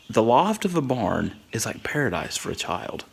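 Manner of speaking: soft whisper, full of wonder; awed whisper. Real, not acted. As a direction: let it out like a cherished secret. Awed secret about childhood paradise.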